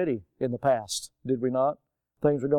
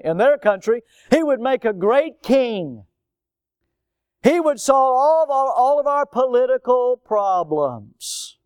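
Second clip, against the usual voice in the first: loud, high, slow on "all of our political problems"; preaching at full force; 7 semitones higher, 5 dB louder than usual. Real, not acted. Pastor voicing what the crowd hoped, preaching loud and high. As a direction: lift it up and stretch it out.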